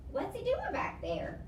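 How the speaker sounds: put-on a small boy's worried question; little boy voice. Toby asks, worried.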